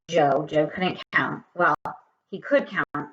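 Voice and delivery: ordinary delivery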